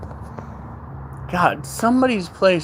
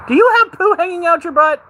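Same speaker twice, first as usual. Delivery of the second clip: calling out; 11 semitones higher, 6 dB louder than usual. Real, not acted. Yelling at dog outside.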